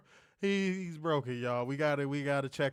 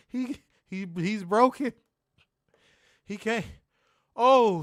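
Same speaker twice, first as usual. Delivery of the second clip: normal talking voice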